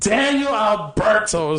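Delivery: put-on slow, drawn-out Spanish-accented name; imitated Spanish accent, stretched out; brother's Spanish accent. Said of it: "That's a good accent".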